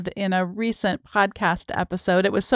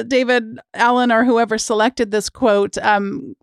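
ordinary talk

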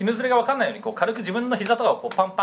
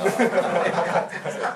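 stifled giggles. Retold or imitated. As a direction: snort of held-back laughter. Stifled giggle at lovers reveal.

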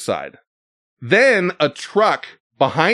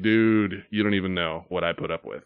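soft, low, tired confiding; weary aside; 7 semitones lower, 8 dB quieter than usual. Retold or imitated. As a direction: murmur it like an exhausted boyfriend. Weary head shake from the boyfriend.

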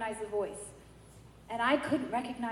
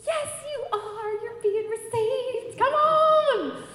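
high, drawn-out singsong cheer; imitating an overjoyed priest calling out; jubilant announcement; 12 semitones higher, 5 dB louder, slower than usual. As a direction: sing it out slowly, building to a loud finish. Priest's jubilant retold answer.

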